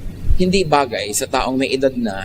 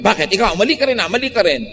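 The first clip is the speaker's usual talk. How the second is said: high, defensive mock comeback; angry retort; 7 semitones higher, faster than usual. Spouse hitting back at the accuser, high and combative. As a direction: throw the blame back, pitched up.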